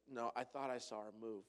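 low, soft deadpan delivery; understated hushed deadpan; deadpan punchline. Retold or imitated. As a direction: drop it quietly, straight-faced. Punchline of the mother-in-law joke.

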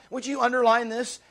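usual speaking voice

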